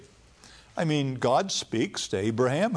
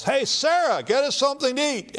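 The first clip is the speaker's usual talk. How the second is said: high, louder call to someone in the back; 12 semitones higher, 4 dB louder than usual. Retold or imitated. Abraham calls to Sarah in the kitchen, loud and high. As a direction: shout it toward the kitchen tent.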